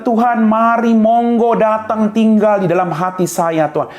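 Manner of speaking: fervent prayer. Retold or imitated. The believer invites Jesus into their heart, fervent prayer. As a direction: pray with full fervour. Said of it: "tapi kita".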